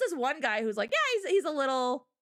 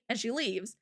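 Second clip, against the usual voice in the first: usual speaking voice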